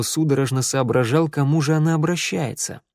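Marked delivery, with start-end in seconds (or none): none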